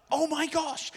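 high-pitched retold astonishment; high, astonished squeal of recognition; amazed recognition. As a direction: act out spotting the very guy, high and amazed. Retold recognition of the pastor online.

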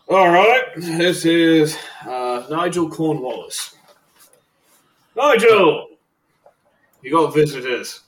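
calling out. Acted. Prison guard calling an inmate to his visitors, loud call.

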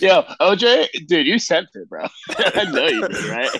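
loud mock frat bro hype. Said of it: "Full Send Podcast".

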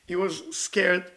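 ordinary talk

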